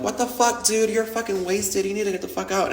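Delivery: high mock-outraged crowd yelling at a drunk; imitated angry stranger. Strangers shoving off a fallen dancer, mock outrage.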